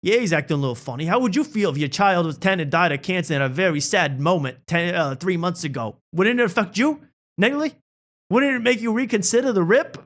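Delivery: mock earnest reply. Friend defends Matt, hammed-up rising outrage. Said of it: "He goes".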